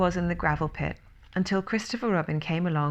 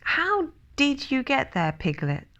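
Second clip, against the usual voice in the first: put-on mildly puzzled boy's question; everyday storybook speaking voice; ordinary voice; 8 semitones higher than usual. A narrator's reading. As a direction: ask it simply, starting high and settling. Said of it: "in his ordinary, ordinary voice".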